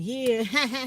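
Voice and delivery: laughing it up, mocking and loud; mock gloating laugh. Imagined gloating at the cousin, mocking laugh. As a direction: cackle like you're glad they got old. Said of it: "I'd have been like".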